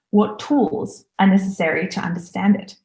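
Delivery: normal talking voice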